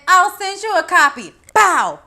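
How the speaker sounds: imitating Gail's retort; mock Gail. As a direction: sass it out then the punch sound. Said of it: "Gail said".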